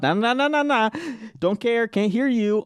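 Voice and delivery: high sing-song nah nah; childish taunt. Acted. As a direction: taunt like a kid covering ears. Childishly blocking out criticism.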